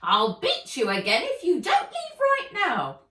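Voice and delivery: high, loud threatening elf. Acted. Elf threatening the old man, high and loud. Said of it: "She was holding a long stick".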